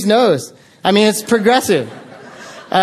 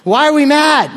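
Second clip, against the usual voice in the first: high-pitched, bewildered mock outrage; mock indignant outburst; 8 semitones higher than usual. Traveler retelling the contagious queue anger, mock indignant. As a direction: act out caught-up crowd anger, pitched right up.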